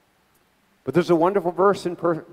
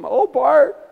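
very high, loud mortified aunt impression; mock dismay, pitched way up; Aunt Rose; 11 semitones higher, 8 dB louder than usual. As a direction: wail it like a scandalized aunt. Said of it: "she was mortified by my leaving the faith".

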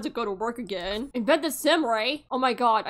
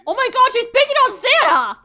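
high, loud indignant cry; outraged shock; 12 semitones higher, 10 dB louder than usual. Real, not acted. Girl bullies Santa.

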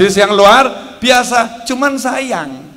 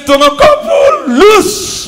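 proclaiming; 11 semitones higher, 7 dB louder, slower than usual. Acted. Preacher proclaims.

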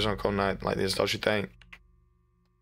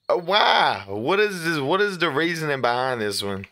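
surprised; 6 semitones higher, 4 dB louder, slower than usual. Real, not acted. Confused reaction to weird game.

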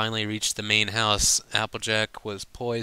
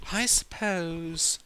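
high, slow, hesitant reply; shy Rarity; 8 semitones higher, slower than usual. Acted. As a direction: give in bashfully. Rarity's shy reluctant answer.

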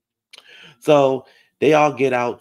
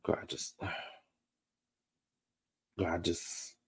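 speechless; 2 semitones lower than usual. Real, not acted. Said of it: "speechless exasperation".

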